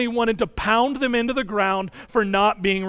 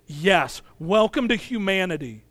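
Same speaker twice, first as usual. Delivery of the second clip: ordinary talk, nothing marked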